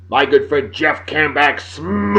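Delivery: hyped shout-out, stretching out "smash" long and loud; drawn-out announcing. Real, not acted. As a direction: announce the name big, holding the last word.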